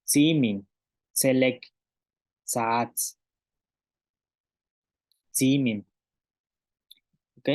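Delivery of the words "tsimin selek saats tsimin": ordinary non-ejective ts in "Tsimin, selek, saats"; careful model words "Tsimin, selek, saats", ts released without glottal pop. Shown as a demonstration. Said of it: "la tsa sin la glotal".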